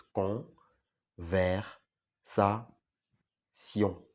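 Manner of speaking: con, ver, sa, sion sounded out French style; French syllables. Shown as a demonstration. Teacher sounds out conversation in French syllables. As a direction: split the word into French syllables.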